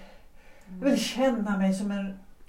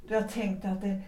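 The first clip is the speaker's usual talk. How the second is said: ordinary talk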